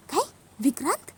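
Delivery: incredulous question, pitch leaping upward; shocked disbelief. Acted. Urja hearing that Vikrant was here, shocked. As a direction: ask it in stunned disbelief. Sister reacts to shocking news.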